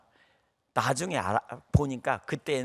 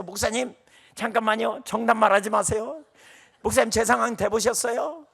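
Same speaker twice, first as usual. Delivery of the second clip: imitating tearful plea; 8 semitones higher, 7 dB louder than usual. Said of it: "막 울으면서 뭐라 그러냐면 이렇게 말해요".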